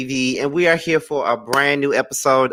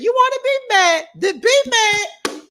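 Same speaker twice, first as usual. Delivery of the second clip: imitating Keke again. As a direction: drag it out, sassy and high. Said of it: "But she said".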